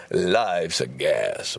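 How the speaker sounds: very slow, low sign-off; lazy slow life's a gas; slow drawled quip. Acted. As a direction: stretch it out lazily.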